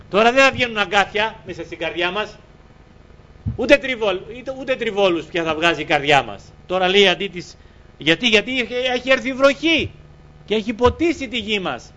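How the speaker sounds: high, urgent sermon voice; impassioned preaching. Real, not acted. Preacher tells congregation their hearts are watered, fervent. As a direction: proclaim it with rising fervour.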